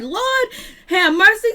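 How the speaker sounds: high, loud mock exclamation; high-pitched church-lady cry; exclaiming Lord have mercy. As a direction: throw the words up high in disbelief. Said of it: "Cynthia was like".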